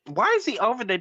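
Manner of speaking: normal talking voice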